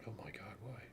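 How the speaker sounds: whispered despair at a gadget; very soft, voiceless exasperation; exasperated whisper. Real, not acted. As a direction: whisper it, pleading and fed up. Scanner will not work.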